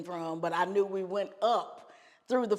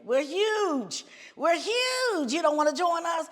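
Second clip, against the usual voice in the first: excited boasting; 9 semitones higher than usual. Retold or imitated. British bandmate boasting, retold.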